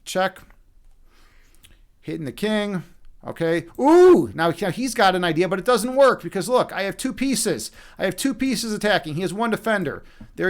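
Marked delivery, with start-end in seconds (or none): 3.8-4.3 loud, high "ooh"; a loud, drawn-out ooh that leaps up; surprised "ooh". Real, not acted. Chess player reacting to the opponent's move, loud high ooh.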